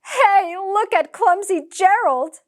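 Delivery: high, loud mocking jeer; sneering. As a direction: taunt him like a playground bully. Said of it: "the animals all sneered".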